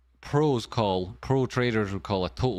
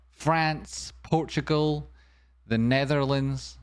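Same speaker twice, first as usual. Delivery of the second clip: normal talking voice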